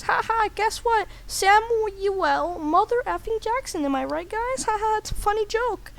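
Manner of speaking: high, silly joker voice; high-pitched obnoxious caricature; stupid annoying guy. Acted. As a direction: crow your own bad joke in a high voice. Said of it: "Some stupid, annoying guy says".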